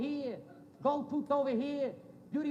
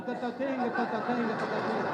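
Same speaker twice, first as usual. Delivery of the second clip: lower-pitched rhythmic mouth effects; steady percussive nonsense patter; flying-sandwich noises; 4 semitones lower than usual. Retold or imitated. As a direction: imitate objects zinging through the air. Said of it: "the sandwich is going".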